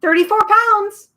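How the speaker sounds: very high, loud shriek. Acted. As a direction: shriek while flying off. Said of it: "shrieked the bird".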